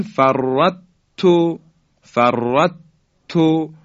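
فرط تو with the ط fully swallowed into a doubled ت; wrong full merge. Shown as a demonstration. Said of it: "اگه بگیم".

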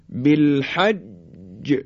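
"بالحج" drawn out, doubled ج held then released; qalqalah on ج. Shown as a demonstration. Teacher models the letter jim in "بالحج", bouncing qalqalah. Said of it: "در حرف جیم توجه بفرمایید".

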